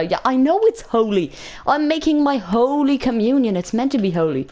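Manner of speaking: mildly indignant pupil reply; insistent retort. Retold or imitated. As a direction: explain it to teacher as if obvious. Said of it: "I said it like this".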